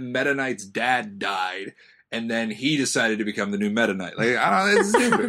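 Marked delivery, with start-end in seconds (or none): 4.2-5.3 laughing. Real, not acted. Laughing rant.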